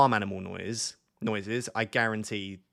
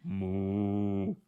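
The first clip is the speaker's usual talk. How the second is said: cow moo. Shown as a demonstration. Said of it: "Do you wanna hear my cow impression?".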